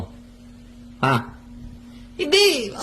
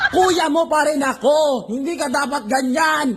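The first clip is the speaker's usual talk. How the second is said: scolding; 7 semitones higher, slower than usual. Acted. Big brother scolding sibling.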